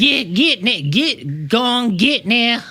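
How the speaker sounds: cowboy sidekick shooing; high old-timer shoo; old prospector voice. Pretend. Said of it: "It's pretty good".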